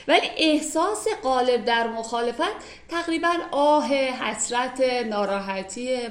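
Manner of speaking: normal talking voice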